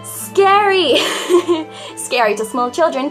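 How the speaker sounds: normal talking voice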